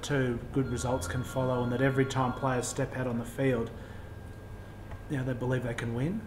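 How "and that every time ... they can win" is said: ordinary delivery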